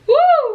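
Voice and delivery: loud, high joyful whoop; excited whoop. Real, not acted. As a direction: let out a proud cheer. Cheering her Haitian pride.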